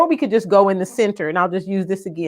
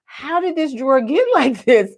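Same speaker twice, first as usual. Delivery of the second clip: laughing disbelief; 3 semitones higher than usual. Retold or imitated. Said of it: "I opened that drawer and I thought".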